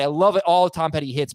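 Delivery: ordinary talk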